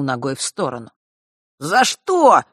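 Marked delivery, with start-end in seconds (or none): 1.6-2.4 high, loud protest; indignant outcry. A narrator's reading. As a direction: cry out in protest. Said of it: "первым очнулся Фэш".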